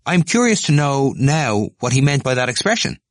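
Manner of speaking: high, loud, pushy; eager question. Acted. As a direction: press Stephen keenly. Temple to crowd.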